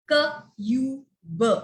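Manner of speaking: "K, U, B" as separate sounds with pauses; long "you" between short k and b; segmented phonics demo of "K, U, B"; sounded out letter by letter. Shown as a demonstration. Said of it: "they could read".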